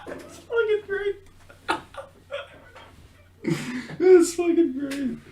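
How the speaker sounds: high, wheezy words through laughter; breaking up with laughter; real helpless laughter. Real, not acted. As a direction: try to speak while still cracking up. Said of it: "why I'm laughing so much".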